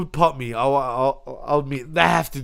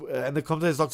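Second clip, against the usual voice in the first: ordinary delivery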